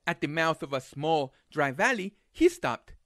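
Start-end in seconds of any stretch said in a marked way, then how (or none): none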